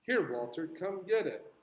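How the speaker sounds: impatient teacher urging a pupil, pitch lifted; creeping impatience. A narrator's reading. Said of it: "Impatience crept into Miss Caroline's voice".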